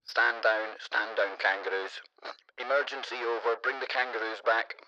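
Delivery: mock official stand-down over a crackly radio; radio command. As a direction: call off the kangaroo squad over the walkie-talkie. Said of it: "That's probably how that sounded".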